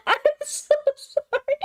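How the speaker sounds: high, slow apology through giggles; high laughing sorry; giggling apology. Real, not acted. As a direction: apologize while laughing helplessly.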